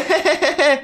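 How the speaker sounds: high, teasing son's giggle; mischievous high-pitched laugh. Acted. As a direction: giggle gleefully at papa. Son laughs at having shot papa.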